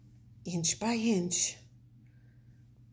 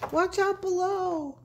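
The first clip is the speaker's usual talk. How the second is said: high, urgent call from above; warning shout; 12 semitones higher, 3 dB louder than usual. Acted. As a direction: yell the warning down. Character warns of falling ball.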